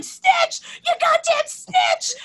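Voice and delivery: high, loud mock yell with a slow, drawn-out second line; jeering yell. Filming a Snapchat video at the snitch, jeering yell. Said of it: "a video in our Snapchat of us yelling".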